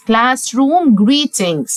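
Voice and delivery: classroom greetings drawn out very slowly; slow model phrase. Shown as a demonstration. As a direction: stretch classroom greetings for repeating. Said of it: "تعالى نستهجاها". (classroom greetings ببطء شديد)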